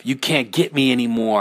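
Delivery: usual speaking voice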